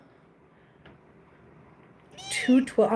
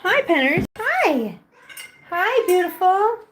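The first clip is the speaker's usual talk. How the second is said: cooing pet voice; 8 semitones higher, slower than usual. Real, not acted. Owner greeting her cats in high cooing baby talk. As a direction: coo at the kittens like a doting owner.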